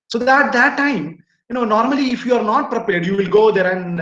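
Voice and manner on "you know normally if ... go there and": usual speaking voice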